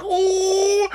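very high, sing-out "ooh"; mock crowd ooh. Everyone reacts to the bully remark, mock crowd ooh. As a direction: imitate a scandalized group reaction.

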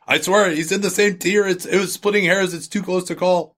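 high-pitched mock defensive whine; mock pleading. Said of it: "That always seems to fall on deaf ears".

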